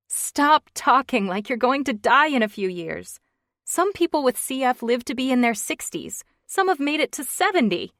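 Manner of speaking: a woman fighting not to cry; holding back a sob. Acted. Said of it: "Holding back a sob".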